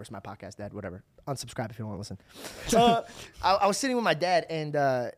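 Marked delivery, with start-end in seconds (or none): 0.0-2.2 much softer, lower throwaway aside; muttered aside. Real, not acted. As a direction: drop the voice for a side remark to dad. Aside to his dad listening.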